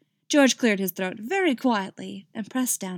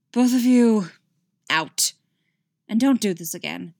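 stern dismissal; 3 semitones higher, 8 dB louder than usual. Acted. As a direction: throw them out of the room. King dismisses them sternly.

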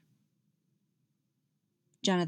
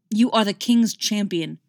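louder, cutting rebuke; angry snap; 7 dB louder than usual. Acted. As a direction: snap it at her like a king. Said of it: "snapped Jonathan".

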